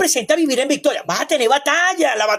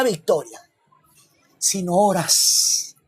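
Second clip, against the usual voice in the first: slow pointed question; 6 semitones lower, much slower than usual. Acted.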